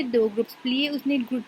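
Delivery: ordinary talk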